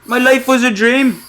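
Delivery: hammed-up confusion, voice jumping up high; mock astonished realisation; shocked disbelief. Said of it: "I'd be like".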